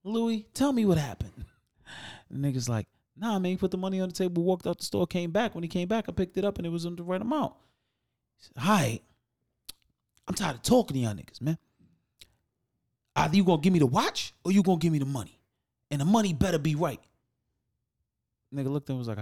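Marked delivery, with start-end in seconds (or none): 0.0-1.4 soothing. Retold or imitated. Narrator calming customer, high soft. 3.2-7.5 employee voice. Retold or imitated. 8.6-17.0 stern ultimatum. Retold or imitated. Customer ultimatum voiced.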